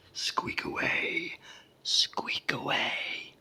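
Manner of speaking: big, slow giant voice, amused; loud and drawn out, like a huge creature; thundering giant. A narrator's reading. Giant amused by his tiny captive, booming slowly. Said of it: "in a voice like very near thunder".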